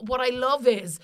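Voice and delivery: ordinary delivery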